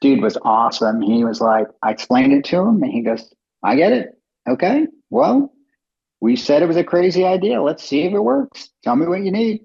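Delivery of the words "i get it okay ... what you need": high, easygoing reassurance; supportive investor. Retold or imitated. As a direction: reassure the nervous founder.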